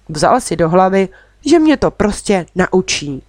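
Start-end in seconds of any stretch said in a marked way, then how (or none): none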